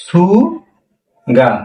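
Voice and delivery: সুগা stretched very slowly, su-gaa; সুগা খুব টেনে ধীরে; drawn out demo. Shown as a demonstration. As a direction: hold সুগা long and slow. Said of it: "হ্যাঁ".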